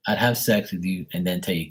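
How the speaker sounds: ordinary talk, nothing marked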